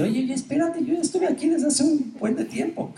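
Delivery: high-pitched indignant protest; mock outrage at a line-cutter; indignant line-stander. Person in a queue telling a line-cutter off, high and indignant.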